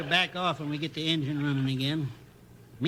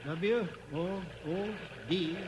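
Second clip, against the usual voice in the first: spelling out; 8 dB quieter, slower than usual. Acted. Reading semaphore letters aloud.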